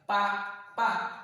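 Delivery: "Pa, pa" as two detached syllables. Shown as a demonstration. Teacher dividing papa for pupils, "Pa, pa" syllable by syllable. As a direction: say "Pa, pa" with a gap between. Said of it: "les découper simplement par syllabe".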